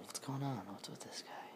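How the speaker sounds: hushed puzzled whisper; wary whispered question. Acted. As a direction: whisper it under your breath. Whispered wondering in scary story.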